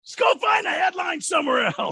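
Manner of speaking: mock shout. Acted outburst at pushy reporter.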